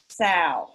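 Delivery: sail said as sow, no final L; sail without L. Shown as a demonstration. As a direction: drop the L completely: sow. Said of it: "I say".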